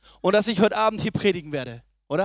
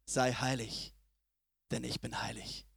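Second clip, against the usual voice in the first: much softer, slow and solemn; hushed quote of God; 13 semitones lower, 14 dB quieter than usual. Acted. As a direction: drop right down and let God's words land softly. God's words quoted in sermon. (leise und feierlich gesprochen)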